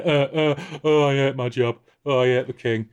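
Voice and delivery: a hammed-up sulky whinge; mock whinging. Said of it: "he just whinges about his job".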